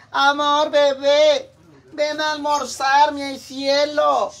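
high, loud, slow syrupy call; doting mother's call. Retold or imitated. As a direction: stretch it out like a smothering mom. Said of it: "Y luego le gritan".

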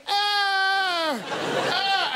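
high yelps, hammed-up; mock cries of pain. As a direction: yelp like she's hurt. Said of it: "on the floor going".

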